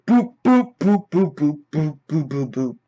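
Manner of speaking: string of boops stepping down in pitch; falling, fading run of boops; boop sound effects. Acted. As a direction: imitate rapid hits, fading away. Imitated hits on each Avenger.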